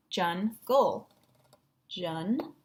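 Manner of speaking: JUNGLE as jun, gol, with a clear gap; split into syllables. Shown as a demonstration. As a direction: say JUNGLE in separate chunks. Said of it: "breaking it into its two parts".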